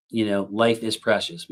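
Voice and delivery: normal talking voice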